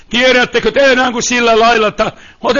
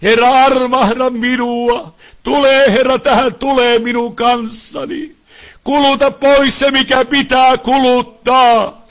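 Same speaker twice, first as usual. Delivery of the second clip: insistent, sustained cries for mercy; pleading prayer; 6 semitones higher than usual. Real, not acted. Preacher asks God why the sickness, pleading prayer. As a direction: implore God with fervent, chanting urgency.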